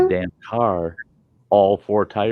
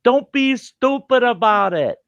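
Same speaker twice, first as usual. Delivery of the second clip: high, slow shouting out the window; dad yelling a warning out of the car window; retold yell; 13 semitones higher, slower than usual. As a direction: call out a warning to the wife. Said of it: "I yell out the window".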